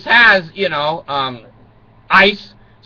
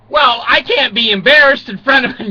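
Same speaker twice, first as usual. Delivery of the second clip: ordinary talk, nothing marked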